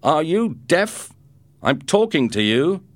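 loud, high, slow menacing yell; bully him, stretch out the words; angry shout. Acted. Bully yells at Ricardo for ignoring him, loud and slow. Gang boy confronts Ricardo.

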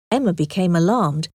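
plain reading voice

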